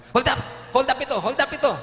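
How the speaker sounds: loud, high robber's yell; high-pitched acted stick-up cry; hold-upper's shout. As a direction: shout it like a joke-story mugger announcing a robbery. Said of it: "inabangan niya, sabi".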